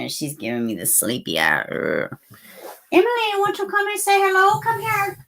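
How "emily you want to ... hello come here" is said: high sing-song pet voice, coaxing. Real, not acted. Owner on stream calling his dog over, high coaxing baby talk. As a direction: coo up high like calling a puppy.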